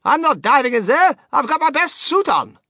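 high, loud flustered husband; exasperated, affronted retort; indignant refusal. A narrator's reading.

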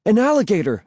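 high-pitched shout of warning; shouted alarm. A narrator's reading. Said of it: "I shouted".

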